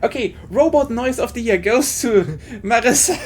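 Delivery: loud mock award announcement through laughter; amused and loud, laughter breaking in; laughing. Real, not acted. Cracking up over robot noises.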